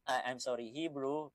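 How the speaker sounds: normal talking voice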